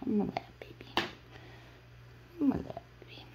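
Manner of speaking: high whispered dog voice; whispered pet voice. Acted. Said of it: "I don't play".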